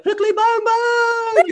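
high, slow, loud squeal; excited attack call. Retold or imitated. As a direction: shriek the attack name gleefully. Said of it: "It's like".

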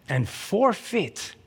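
"forfeit" drawn out slowly; stress on forfeit. Real, not acted. Preacher underlines a key scripture word, stressing "forfeit". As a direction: stretch "forfeit" slowly to make it weigh. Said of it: "I'm stressing the word forfeit".

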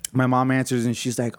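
normal talking voice

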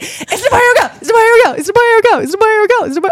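high, loud, insistent, over and over; frantic repeated asking. Retold or imitated. Mother asking her husband about the baby, frantic, high and loud. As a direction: demand an answer again and again. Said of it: "and I was like".

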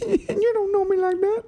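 very high sassy squeal; teasing friend hamming up a diva line. Pretend. Joking sassy echo.

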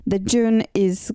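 ordinary delivery

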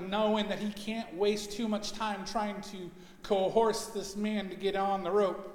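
normal talking voice

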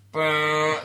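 loud, high mock trumpet noise; a playful retold horn blast. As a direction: blare it like a horn. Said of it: "popped up through the trapdoor and went".

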